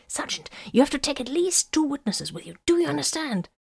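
high-pitched, hushed urgent instruction; urgent order. A narrator's reading. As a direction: press the order over the comm. Solomon gives urgent orders over comm.

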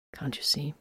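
a soft, weary question to a fellow healer; quiet question. A narrator's reading. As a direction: let it drop to near a murmur. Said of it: "Dankwart asked quietly".

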